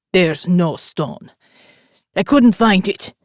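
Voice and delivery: put-on a thug with a rough Italian accent. A narrator's reading. Biker under the gun, rough Italian accent. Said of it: "rough Italian accent".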